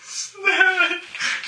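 high squeal of alarm; shocked shriek. Real, not acted. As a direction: shriek it out in surprise. Player reacts to snakes.

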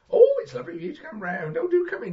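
put-on high old lady voice. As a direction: play a sweet old lady. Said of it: "I go".

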